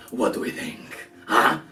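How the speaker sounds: breathy taunting whisper; menacing whisper. Acted. Strahd standing over crumpled Winnie, a menacing whisper. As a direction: lean in close and whisper the threat.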